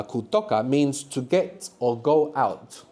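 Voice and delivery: Kutoka said slowly, each syllable clear; slow, clear model. Shown as a demonstration. Teacher modelling Kutoka slowly for the class. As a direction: spell Kutoka out slowly for learners. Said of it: "Say it".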